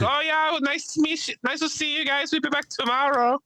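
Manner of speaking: put-on very high, sing-song German-accented farewell; high falsetto German accent; mock German goodbye. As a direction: chirp it like the polite German tourists. Imitating the German couple leaving.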